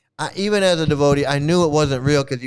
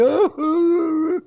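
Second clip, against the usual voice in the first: high imitated crying wail; mock sob; 12 semitones higher than usual. Imitating his crying friend, high mock sob. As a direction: cap it with a fake high sob. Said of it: "he's crying".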